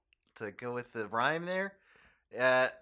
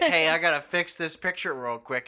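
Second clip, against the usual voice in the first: loud mock voice; 7 dB louder than usual. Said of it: "was like".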